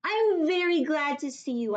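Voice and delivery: put-on high, loud little girl yelling for help; Junie B. yelling. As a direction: cry for help like a panicked kid. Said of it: "I said".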